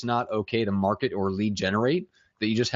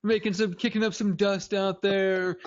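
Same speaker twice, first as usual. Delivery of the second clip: higher, hammed-up tough-guy mimicry; mock caller voice; 10 semitones higher than usual. Cohost quotes the voicemail caller, mocking high voice. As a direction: echo the caller in a silly high voice. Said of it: "parodying caller's lines".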